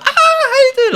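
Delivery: very high squealed hello, laughing; mock screaming greeting. Strippers greeting the lads, screaming in mock excitement. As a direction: imitate girls shrieking hello. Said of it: "they're all screaming".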